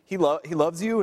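normal talking voice

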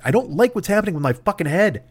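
ordinary talk, nothing marked

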